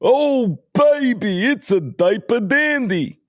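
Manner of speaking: high, loud, hyped-up sportscaster impression; wildly excited high-pitched announcer shout; Dick Vitale. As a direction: belt it out like an overexcited basketball commentator, stretching each word. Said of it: "you wanna hear Dick Vitale?".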